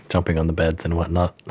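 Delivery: ordinary talk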